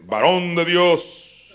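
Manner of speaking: loud, drawn-out mock reverence; hammed-up "man of God" announcement; mock pious proclamation. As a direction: proclaim it grandly, mocking the showy holy man. Mocks showy religious men.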